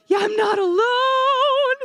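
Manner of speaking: high, drawn-out cheer; triumphant cry. Real, not acted. Speaker finding fellow bucket-bath takers, triumphant cry. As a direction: stretch it out high in happy relief. Celebrates audience members' raised hands.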